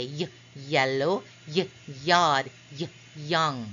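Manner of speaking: put-on short "y" alone before each of "yellow", "yard", "young"; sounding out Y. Shown as a demonstration. Teacher reminding Y is a consonant, sounding out "y, yellow". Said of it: "You hear".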